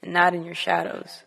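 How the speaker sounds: measured, deliberate poetry recital; spoken word poem. Acted. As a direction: recite it line by line, weighing each phrase.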